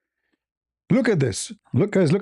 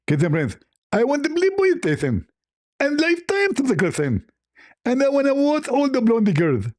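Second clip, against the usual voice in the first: Zakir Naik; 7 semitones higher than usual. Acted. Caller lists his blackmail demands, high squealed impression. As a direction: squeal the demands out, mock greedy. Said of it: "uh, Zakir Naik, you remind me".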